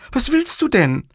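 high-pitched protest; a high woman's voice, taken aback; indignant Livia. Acted. Livia questions Montalbano's embrace, high and indignant.

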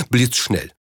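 plain reading voice

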